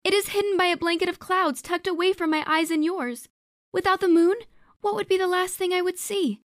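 very high, loud, fast, theatrical; grand projected plea. A narrator's reading. Maga performs grandly for the spirits, loud. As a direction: play to the back row. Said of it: "to gesture grand so those in the back could see".

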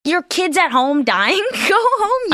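high, slow disbelieving scold; incredulous. Retold or imitated. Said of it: "I was like".

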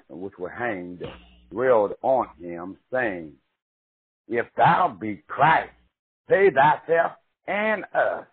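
slow, emphatic Bible reading with swooping pitch; dramatic scripture reading. Acted. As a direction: read the verses like a preacher, swelling on key words. Reads scripture aloud dramatically.

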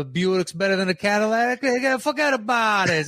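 high, cocky mobster bragging; boastful mobster quote. Retold or imitated. Bragging to a pal about his Buick, mobster style. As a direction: brag about the car like a wiseguy. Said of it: "Sometimes it's like".

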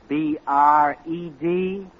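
letters spelled out slowly one by one. Acted. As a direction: spell each letter slowly, like a secret code. Spelling out bread to hide it.

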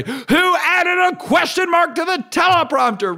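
high mock outraged shout; freaking out. Newsroom staff freak out over the typo, high shout. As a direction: yell it up high in mock panic. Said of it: "people freak out and say".

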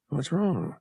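soft, concerned question; asked quietly. A narrator's reading. Han quietly asks the alien what's wrong, softly. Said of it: "he asked quietly".

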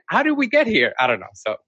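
ordinary talk

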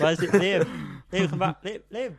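playful shouts after a missing friend; mock calling out. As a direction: call out high and loud, as if he's wandered off.

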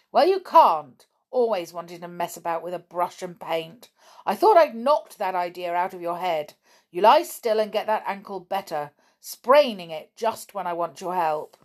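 angry voice. Acted. Father angrily scolding his injured son for painting. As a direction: bellow at the boy like a raging father. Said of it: "they heard an angry voice".

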